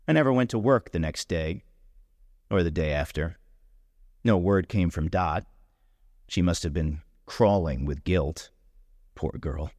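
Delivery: plain reading voice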